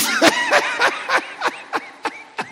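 real high-pitched wheezy laughter; long high laugh. Real, not acted. Preacher joking about secret talents, long high laugh. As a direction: break up at your own joke.

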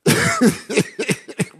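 high burst of laughter; real laugh. Real, not acted. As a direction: let a laugh break out. Preacher laughs.

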